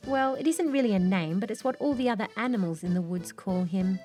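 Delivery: plain reading voice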